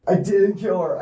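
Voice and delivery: ordinary talk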